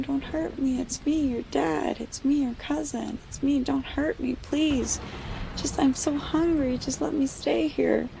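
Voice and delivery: mosquitoes wearing loved ones' voices, beg; pleading family voices. Acted. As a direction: mosquito family begs Kano, high and pleading. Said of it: "they were like".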